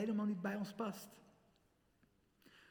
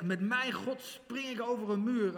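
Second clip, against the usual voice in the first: higher, louder, triumphant declaration; bold proclamation; 7 semitones higher, 4 dB louder than usual. Retold or imitated. Youth speaker quoting David, boldly proclaimed. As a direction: proclaim it like a leap of faith. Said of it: "Denk aan David die zegt".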